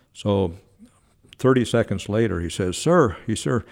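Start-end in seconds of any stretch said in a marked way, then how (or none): 2.8-3.2 high, loud, urgent address; imitated eager trainer; excited trainer. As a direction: blurt it like a flustered underling. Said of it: "He was so excited".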